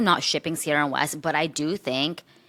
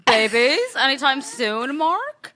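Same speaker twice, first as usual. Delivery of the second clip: high, loud, rising impression; hint coyly in a put-on high voice; Kristen Cavallari; 9 semitones higher, 3 dB louder, slower than usual. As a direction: climb higher into an exaggerated question. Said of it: "Kristen Cavallari".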